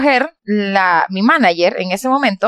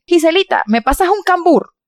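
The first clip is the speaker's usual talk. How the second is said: higher, sweet imitation of the manager asking; imitating the manager; 8 semitones higher than usual. Imitating her manager asking for a fruit, higher sing-song voice.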